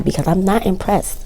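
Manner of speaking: ordinary talk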